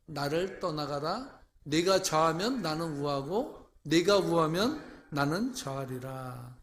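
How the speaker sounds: slow, loud, solemn reading of the verse; scripture reading. Acted. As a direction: proclaim the verse to the congregation. Reads scripture aloud. (느리고 힘찬 성경 봉독)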